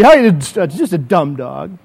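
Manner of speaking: slow, low shrug of a line; dismissive. Pretend. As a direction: wave it off as nothing. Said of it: "and I go".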